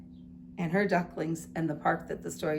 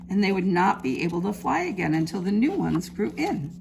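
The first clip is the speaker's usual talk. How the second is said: lively picture-book storytelling; reading to children; 4 dB louder than usual. A narrator's reading. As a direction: read it like storytime for little ones. Teacher reads picture book aloud.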